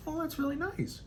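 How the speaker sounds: high, sweetly polite reply; mock polite. Strangers hearing about the daycare job, high polite gush.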